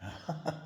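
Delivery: soft real laugh; brief, quiet amused laugh; short chuckle. Real, not acted. Preacher amused by the congregation's answer, soft chuckle.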